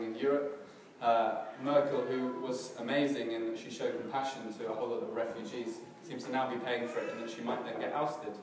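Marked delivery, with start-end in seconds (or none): none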